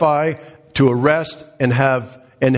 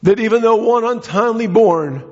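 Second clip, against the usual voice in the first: higher, driving sermon voice; fervent preaching; 7 semitones higher than usual. Acted. Preacher stirring the congregation about Saul, fervent and high. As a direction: preach it with rising fire.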